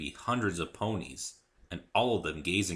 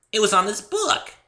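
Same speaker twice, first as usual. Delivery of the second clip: put-on much higher, louder giddy pony girl; bubbly Pinkie Pie; 14 semitones higher, 11 dB louder than usual. A narrator's reading. Cheerful pony introducing herself to Dave, high and bubbly. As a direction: chirp it out like an overexcited pony.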